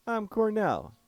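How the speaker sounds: put-on German accent that slips and misfires; German accent attempt gone wrong; botched German accent. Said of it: "I screwed up that accent".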